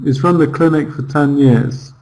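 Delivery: "is run" merged from he has run, no H; words merged. Shown as a demonstration. Teacher demonstrating present perfect, "is run" merged without H. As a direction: run "is run the clinic" together, he has reduced. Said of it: "we would just merge together".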